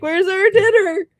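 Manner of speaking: high, loud, drawn-out mock demand; put-on high dog voice, insistent; hungry dogs' voice. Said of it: "they didn't get dinner, so they're like".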